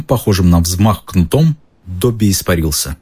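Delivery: usual speaking voice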